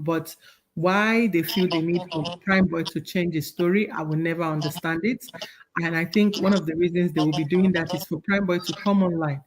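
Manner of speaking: ordinary talk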